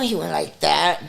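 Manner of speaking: ordinary delivery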